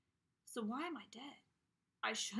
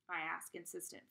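usual speaking voice

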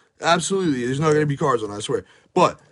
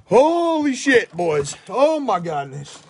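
excited drawn-out cry; 5 semitones higher, much slower than usual. Real, not acted. Big card pull.

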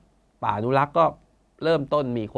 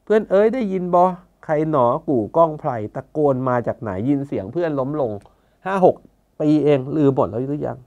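louder, higher plea quoted from the song; calling out; 4 semitones higher, 5 dB louder than usual. Retold or imitated. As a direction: call out to friends who have forgotten. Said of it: "แล้วก็เรียกร้องว่า". (ตะโกนเรียกร้อง เสียงดังและสูงขึ้น)

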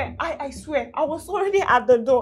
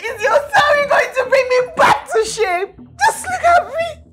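raised, cracking voice, near tears; tearful shout; 7 semitones higher than usual. Acted. Wife shouting back at the man who hit her, tearful. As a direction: throw it back at him, voice high and raw. Beaten wife confronts apologising partner.

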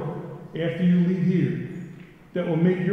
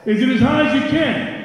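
loud and high, firing them up; hyping the crowd; 9 semitones higher, 6 dB louder than usual. Real, not acted. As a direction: pump up the room. Crowd call-and-response.

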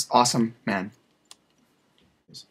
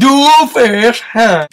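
slow loud drawl; 7 semitones higher, 11 dB louder, much slower than usual. Acted. Drawn-out mock.

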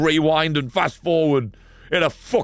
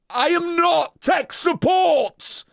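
slow, drawn-out yell, much higher; furious shout; 14 semitones higher, much slower than usual. Real, not acted. Streamer yelling at chat about tech support, furious shout. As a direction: bellow it at the chat.

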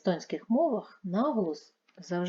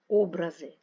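Образи with a loud, long first О; stress on first syllable; 6 dB louder than usual. Shown as a demonstration. Teacher explaining images in art, first-syllable stress on Образи. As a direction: hit the opening О of Образи. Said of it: "наголос на першому складі".

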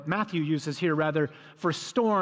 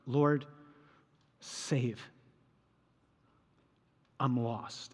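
quiet prayer; 6 semitones lower, 6 dB quieter, much slower than usual. Shown as a demonstration. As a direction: lower and softer, a pleading prayer. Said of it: "this is how I pray with this scene".

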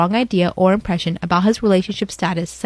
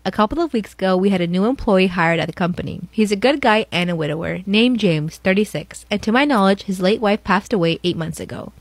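usual speaking voice

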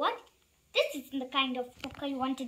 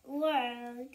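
ordinary delivery

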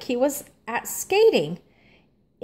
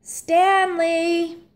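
higher calling yell; yelled name; 8 semitones higher than usual. A narrator's reading. Arthur yells to Stanley on the ice, a high call. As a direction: shout his name across the ice. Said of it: "yelled Arthur".

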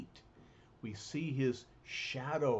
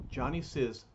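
plain reading voice

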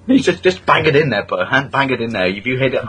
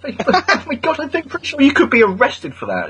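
shocked laugh; 11 semitones higher than usual. Real, not acted.

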